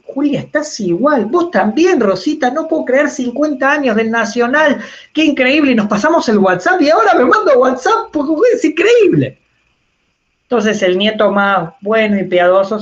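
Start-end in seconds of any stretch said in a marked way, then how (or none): 0.0-9.4 put-on high, thrilled old-lady voice; gushing grandmother. Speaker voicing grandma reliving her reunion with Julia: high and thrilled. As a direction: squeal out the reunion like a delighted grandma.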